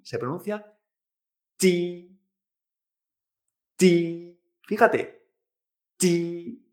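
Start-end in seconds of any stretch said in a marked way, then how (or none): 1.5-6.7 "ti" as Mandarin "ji", held on a level tone; sustained, unaspirated Mandarin "ji" for "ti"; Chinese J sound. Shown as a demonstration. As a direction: say "ti" between Spanish ch and English jeep, no puff of air. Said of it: "la J en chino la vamos a pronunciar".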